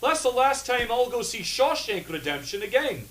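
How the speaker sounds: put-on Scottish accent. Scottish character insulting the fake Morgan Freeman in a Scottish accent. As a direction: snap at Morgan like a furious Scotsman.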